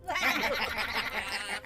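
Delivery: high, distant mocking gnome laughter; high echoing jeering laugh. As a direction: laugh from afar like unseen gnomes. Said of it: "Only the mocking laughter replied".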